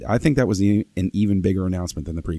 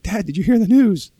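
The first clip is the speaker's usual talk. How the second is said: excited; 13 semitones higher, 5 dB louder than usual. Retold or imitated. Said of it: "I was like".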